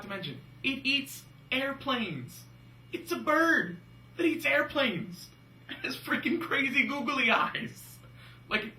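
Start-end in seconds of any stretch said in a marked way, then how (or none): 0.6-8.0 high, slow, loud disbelief; incredulous mockery. Real, not acted. Host describing the plane-eating puppet bird, incredulous mockery. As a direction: stretch out the absurdity, pitched way up. Mock amazement at the silly monster.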